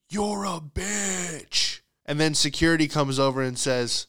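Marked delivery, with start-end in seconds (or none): 0.1-1.8 drawn-out, high, held-back scream; mock scream. Tackling a stranger in the store, mock scream in the ear. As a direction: fake a slow scream without full volume. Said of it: "scream in your ear".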